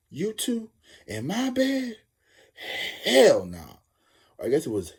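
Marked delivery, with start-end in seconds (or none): 0.1-2.0 much higher, slow disbelief; shocked. Retold or imitated. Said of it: "she's like". 3.0-3.8 louder, flat hell no; loud refusal. Retold or imitated. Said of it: "she's like".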